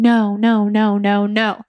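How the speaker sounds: ordinary talk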